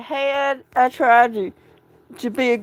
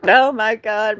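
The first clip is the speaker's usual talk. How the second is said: ordinary delivery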